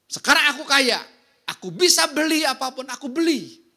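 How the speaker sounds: loud, high, bragging "now I'm rich"; boastful rich man. Pretend. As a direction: crow it like a show-off who buys everything. Voicing the proud newly rich. (lantang dan sombong seperti orang kaya baru)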